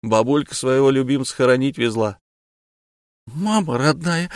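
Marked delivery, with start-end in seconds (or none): 3.3-4.3 high startled squeal. Acted. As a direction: shriek it in alarm. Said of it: "взвизгнула ёлка".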